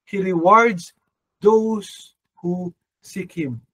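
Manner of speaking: slow, weighty scripture quote; slow scripture emphasis. Acted. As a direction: draw out each word like a verse. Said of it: "sabi dito ng Panginoon". (dahan-dahan at mabigat na pagbasa ng talata)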